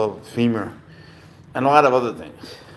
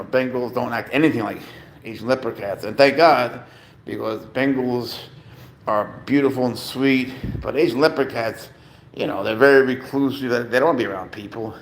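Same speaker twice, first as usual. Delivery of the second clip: ordinary talk, nothing marked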